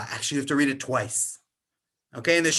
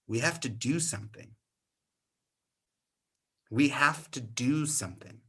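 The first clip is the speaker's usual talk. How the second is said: hushed determination; 3 semitones lower, 5 dB quieter than usual. Retold or imitated. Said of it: "And Esther says".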